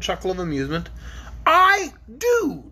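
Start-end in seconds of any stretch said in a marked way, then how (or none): 1.4-2.7 high defiant king voice; gruff Gnome King. A narrator's reading. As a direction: declare it like a stubborn king. Ruggido answers defiantly.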